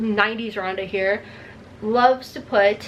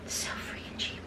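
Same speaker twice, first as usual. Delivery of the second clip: hushed self-mocking whisper; admitting to viewers she's cheap, whispered; whispered aside; 16 dB quieter than usual. Real, not acted. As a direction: whisper it as a guilty admission.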